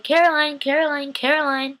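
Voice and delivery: high, repeated name call; imitated calling out. Recapper as friends looking for Caroline, high acted calling.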